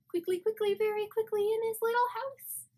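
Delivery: bright quick mouse line building up; playful rush into the tickle; lively mouse verse. Shown as a demonstration. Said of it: "let's try it again".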